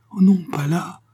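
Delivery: high-pitched protest in sudden dread; frightened, urgent refusal; alarmed cry. A narrator's reading. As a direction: cry out in fear, pitch leaping up. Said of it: "s'écria-t-elle".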